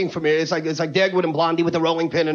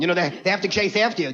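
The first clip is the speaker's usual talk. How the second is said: ordinary delivery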